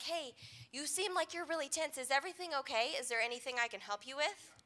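soft, caring check-in; quietly reaching out to someone tense; gentle concern. Retold or imitated. Gently checking on loved one aside.

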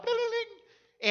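high, slow sing-song bell chime; imitating a door bell. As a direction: ring it out like a shop doorbell. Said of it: "the little bell went".

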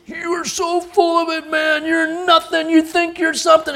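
high-pitched mock tirade; acted scolding, strained and high; giving a chewing-out. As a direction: imitate an angry guy telling you off. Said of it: "he's just giving me the what for".

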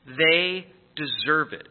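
"They deserve it" drawn out slowly, each word weighted, "They" leaned on; emphatic, slow. Shown as a demonstration. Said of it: "It's emphatic".

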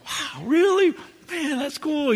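high, wide-eyed retold wonder; amazed. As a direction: gush a little at the story. His own amazed reaction retold.

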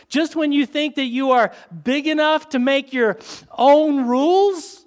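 rising preacher's cry; preaching climax. Real, not acted. Preaching builds to a peak.

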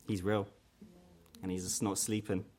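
usual speaking voice

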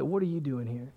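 low, very soft disapproving question; hushed judging onlooker. Retold or imitated. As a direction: voice a judging onlooker under your breath. Voicing onlookers who judge him.

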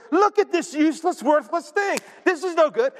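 scornful villager. Retold or imitated. Preacher voicing villagers scorning a bruised reed. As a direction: scoff at the worthless reed.

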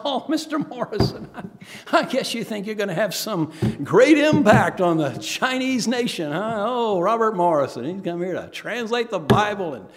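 loud, high, hammed-up sarcasm; mocking taunt. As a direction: jeer with exaggerated mock awe. Acting out scoffers taunting the missionary.

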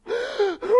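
a high, put-on gasp of dismay; whiny gasp. Complaining Israelite gasps at Moses. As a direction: gasp like a complainer about to whine. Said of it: "Whining and complaining".

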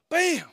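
sharp high shouted bam; mock headbutt bam. Preacher acting the bully's headbutt, sharp bam. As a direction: smack out the hit like a punch. Headbutt sound in bus story.

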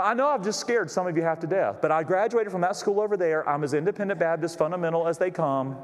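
ordinary talk, nothing marked

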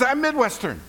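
high delighted squeal; excited echo. Retold or imitated. Rescuer squealing in delight at a fellow Midwesterner. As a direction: gush it back joyfully.

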